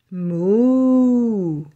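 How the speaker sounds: a long, drawn-out moo like a cow. Pretend. Reading an animal book to kids, a playful cow moo. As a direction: moo it out for the little ones.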